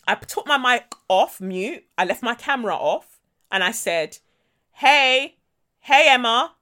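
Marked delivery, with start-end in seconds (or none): 4.7-6.5 loud, sing-out mock-friendly hello; knowing call-out, each greeting set apart; pointed greeting. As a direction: let them know you overheard everything. Breaking in on open mic.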